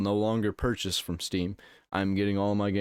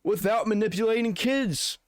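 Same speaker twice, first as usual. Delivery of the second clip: hammed-up outraged defence, pitched up high; mock indignation; 7 semitones higher than usual. Streamer sarcastically defending Valve to viewers, mock indignant.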